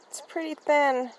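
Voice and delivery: a touch of nerves; nervously. Acted. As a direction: tread carefully, uneasy. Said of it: "she said nervously".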